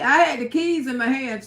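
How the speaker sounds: normal talking voice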